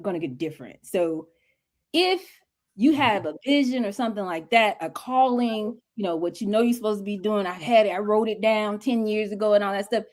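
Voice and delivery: usual speaking voice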